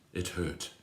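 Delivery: ordinary delivery, nothing marked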